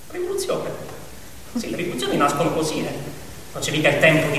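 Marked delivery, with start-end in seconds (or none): 0.1-0.7 quiet question. A narrator's reading. Echoing the word as a doubt.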